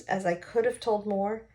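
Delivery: ordinary delivery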